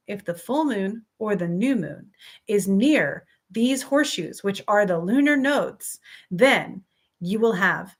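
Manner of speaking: deliberately slowed, measured delivery; careful, spaced-out phrasing. Real, not acted. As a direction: say it slowly so the words don't trip you up. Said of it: "I had to say it slowly".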